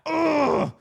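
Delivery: loud high-pitched strained groan; frustrated ugh. Retold or imitated. Player showing how he acted in front of someone, frustrated groan. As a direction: let out a hammed-up tantrum noise. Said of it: "Like, I'm doing that".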